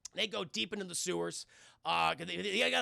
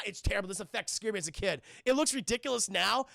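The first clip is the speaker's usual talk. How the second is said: normal talking voice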